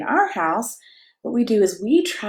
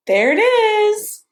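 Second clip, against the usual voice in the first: excited discovery; 11 semitones higher, 5 dB louder, slower than usual. Real, not acted. Spots baby fish for toddler.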